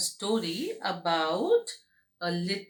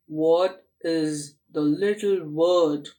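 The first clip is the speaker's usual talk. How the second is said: lower, slow gentle monster; quiet monster voice; 5 semitones lower than usual. Acted. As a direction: ask it slowly like a calm monster. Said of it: "in a quiet voice".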